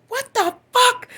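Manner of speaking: high slow shocked shriek; shocked cry. Retold or imitated. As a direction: scream it in stunned disbelief. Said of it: "And I'm like".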